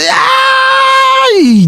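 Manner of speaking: long high-pitched imitated yell; wild cry held high; mock scream. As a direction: yelp it out high and drawn-out. Imitating the brothers' flying-leap cries.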